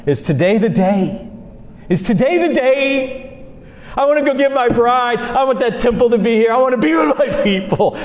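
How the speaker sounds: high, impatient longing; acted eagerness, pitch lifted; eager bridegroom. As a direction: plead like a groom who can't wait. Acted Son eager for his bride.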